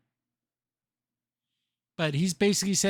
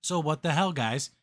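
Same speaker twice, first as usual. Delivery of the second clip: normal talking voice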